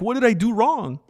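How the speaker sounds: high, imitation of sincere bafflement; mock honest innocence. Imitating fighter after a foul, mock honest innocence. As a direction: ask it high, as if truly blameless. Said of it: "He does it so honestly and sincerely".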